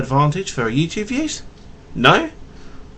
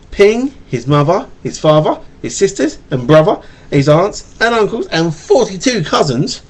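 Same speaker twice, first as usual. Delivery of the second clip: storybook read-aloud to a little one; reading to a child; 2 semitones higher, 3 dB louder than usual. Acted. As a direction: read it gently like a bedtime tale.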